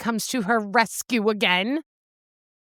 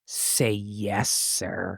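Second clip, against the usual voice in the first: low quiet; 12 semitones lower, 5 dB quieter, much slower than usual. Retold or imitated. Sultry low line from book.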